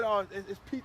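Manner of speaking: ordinary delivery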